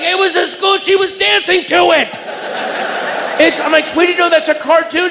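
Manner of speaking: high mock-shouted excuse; high flustered dad explaining. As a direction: defend yourself in a high panic.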